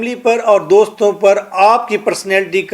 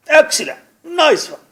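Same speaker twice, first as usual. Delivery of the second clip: much higher, excited praise; impressed friend; 8 semitones higher than usual. Retold or imitated. A friend praises the new car in a much higher, excited voice. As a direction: gush over the new car. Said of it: "یہ کہے".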